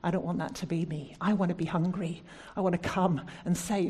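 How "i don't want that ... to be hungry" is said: ordinary talk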